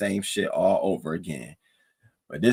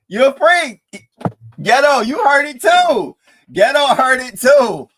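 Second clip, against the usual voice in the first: much louder and higher, slow and drawn out; hyped yell; 10 dB louder than usual. Real, not acted. Streamer loudly calling Lemon a freak to chat, hyped yell. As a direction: call him out to the whole chat.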